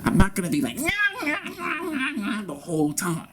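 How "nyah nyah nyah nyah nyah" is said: high-pitched mocking brat whine; bratty nyah-nyah whine. Mocking what he won't do all the time, bratty whine. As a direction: whine "nyah" like a taunting child. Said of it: "I can't be like, "Snotty Nose Brat".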